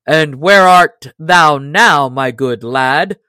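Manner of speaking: slow, loud taunt, no real laugh; jeering shout. A narrator's reading. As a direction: call out slowly, mocking the loser. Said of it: "shouted the stranger, roaring with laughter".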